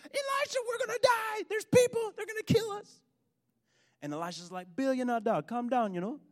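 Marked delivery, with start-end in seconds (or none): 0.0-3.0 high-pitched hammed-up panicked cry; mock panic. Servant running to warn Elisha they'll die, mock panic. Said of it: "he runs back in panic". 4.8-6.2 higher, softer put-on Jamaican voice; calm him down in a Jamaican accent. Elisha calming his panicked servant, put-on Jamaican accent. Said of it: "his Jamaican roommate, he, he always talked like that".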